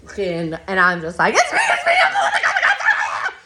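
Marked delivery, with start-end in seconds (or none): 1.3-3.3 much higher, louder panicked cry to the cops; freaking out. Retold or imitated. Facing armed deputies at the door, panicked shriek. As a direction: squeal it out, terrified of the guns. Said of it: "I freak out again".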